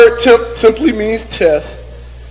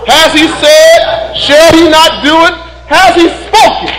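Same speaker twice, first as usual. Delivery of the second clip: loud, raised-pitch preaching shout; full-force preaching; 7 semitones higher, 4 dB louder than usual. Acted. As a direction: call it out to the congregation like a fired-up preacher.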